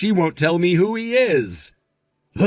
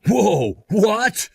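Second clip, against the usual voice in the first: higher, startled rising question; taken aback; 7 semitones higher than usual. Acted. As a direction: react to an outrageous request. Asked to pay her legal bills.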